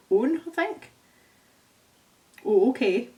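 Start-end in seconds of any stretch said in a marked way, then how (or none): none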